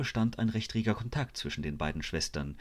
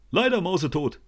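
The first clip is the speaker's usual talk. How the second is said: police doctor; 11 semitones higher, 7 dB louder than usual. A narrator's reading. Police doctor reports the death.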